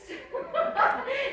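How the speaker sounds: a high, loud burst of laughter. Real, not acted. Laughs at vibrator story.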